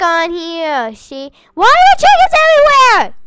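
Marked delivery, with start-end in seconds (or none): 1.5-3.1 loud, high, fast outburst; surprised shout. Real, not acted. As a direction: blurt it out in disbelief. Finds chickens all over house.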